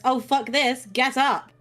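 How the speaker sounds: gruff wake-up call. Retold or imitated. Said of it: "You hear the lovely words".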